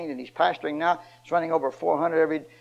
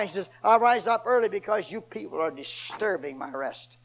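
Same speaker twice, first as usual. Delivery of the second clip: full-force preaching; 8 semitones higher than usual. Acted. Preacher to his congregation, at full force.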